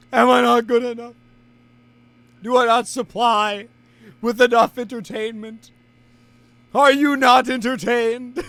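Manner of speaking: loud, high theatrical declamation; mock indignant shout. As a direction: bellow it like an arena challenge, hammed up. Mock gladiator challenge to audience.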